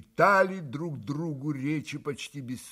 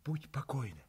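much softer, hushed; quick whisper; 10 dB quieter than usual. A narrator's reading. Katya whispers a promise to Grushenka. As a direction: hush it quickly. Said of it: "быстро прошептала Катя".

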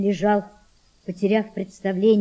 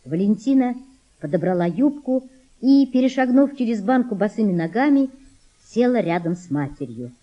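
usual speaking voice

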